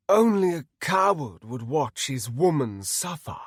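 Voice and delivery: grand villain sneer; taunting villain paladin. Acted. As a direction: taunt him with contempt.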